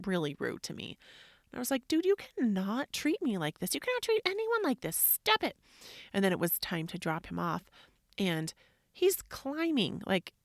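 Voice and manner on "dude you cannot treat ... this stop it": high, rising parental telling-off; scold, building to a sharp command; indignant scolding. Retold or imitated. Mom telling rude son off, re-enacted indignation.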